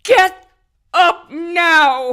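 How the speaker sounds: high, loud demand; desperate shout. Acted. Wife desperately ordering Carl up, high and loud. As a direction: shout him up off the floor.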